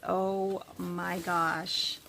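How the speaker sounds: ordinary talk, nothing marked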